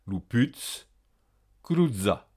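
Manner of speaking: "Luputz" ending in ts, "cruzza" with dz. Shown as a demonstration. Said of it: "T Z prononcé normalement". (« Luputz » en ts, « cruzza » avec dz)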